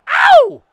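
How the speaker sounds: high, loud whooping yelp; one sharp, very loud cry; celebratory yelp. Real, not acted. Player reliving his top-shelf shot, celebratory yelp.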